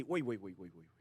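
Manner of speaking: soft, low, fading string of waits; imitating daughter's habit. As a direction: mimic a child stalling to think. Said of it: "Hace así".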